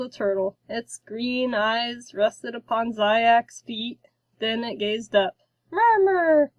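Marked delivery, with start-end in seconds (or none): 5.7-6.5 put-on much higher, loud baby-monster squeal; ecstatic creature cry. A narrator's reading. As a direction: squeal it with delight. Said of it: "the little one screamed with an ecstatic smile".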